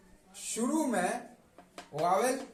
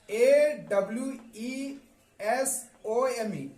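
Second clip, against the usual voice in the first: slow spelling; slower than usual. Shown as a demonstration. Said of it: "teacher spells word letter by letter".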